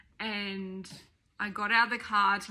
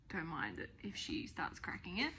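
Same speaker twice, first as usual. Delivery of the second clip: whisper; 3 semitones lower, 11 dB quieter than usual. Real, not acted. Mother whispering to viewers as baby nears nap time, whisper. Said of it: "it's almost nap time for this one".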